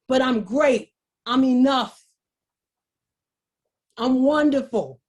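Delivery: firm self-affirmation, each one falling to a close; affirming declaration. Acted. Speaker voicing somebody else claiming their worth, firmly affirming. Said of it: "and let somebody else say".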